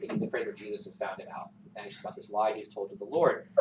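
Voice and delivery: ordinary delivery, nothing marked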